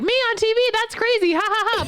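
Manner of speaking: high fake-cheery nurse. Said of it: "She's like".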